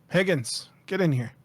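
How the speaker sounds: put-on calling out loud in a British accent; a British boss calling through a door; British accent, called out. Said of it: "call out to you in a British accent".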